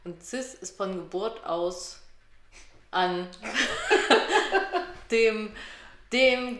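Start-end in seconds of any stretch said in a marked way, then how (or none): 2.9-6.6 laughing. Real, not acted. Laughing while explaining cis.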